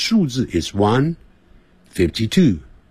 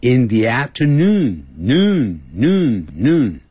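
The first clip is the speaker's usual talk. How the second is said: slow "afternoon" with "noon" repeated like an echo; drawn-out, repeating "noon, noon, noon"; much slower than usual. Shown as a demonstration. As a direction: let each "noon" swoop up and fall. Teacher models word with repeated echo.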